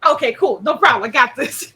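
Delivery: bouncy pup who gets the joke; eager dog eager to say hi. Acted. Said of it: "Rusi kinda puts it together".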